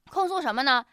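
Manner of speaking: ordinary delivery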